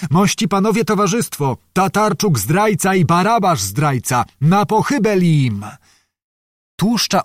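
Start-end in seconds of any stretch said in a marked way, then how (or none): 0.1-5.6 higher, rousing call to the crowd. Acted. Czarnota at the door rousing the crowd, higher rousing call. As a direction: whip up the mob outside. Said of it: "wołał do zgromadzonego tłumu".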